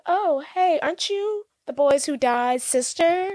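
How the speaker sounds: high, slow fake-innocent sweetness; playing dumb. Antoinette to Catherine, faking innocence in a high slow voice. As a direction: act clueless and sugary, high and slow. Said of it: "Antoinette's playing stupid".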